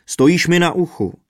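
loud, high cry of pain; bellowed, aggrieved complaint; roared protest. A narrator's reading. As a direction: yell at the one standing on you. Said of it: "zařval bubeník".

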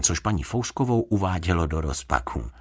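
usual speaking voice

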